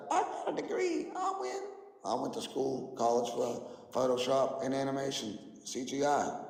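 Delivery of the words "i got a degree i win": high, smug mock quote of the other guy; mocking opponent's voice. Streamer mocking a debater's credentials, high smug mock voice. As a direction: sneer it like a know-it-all with a degree. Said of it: "All he's got is".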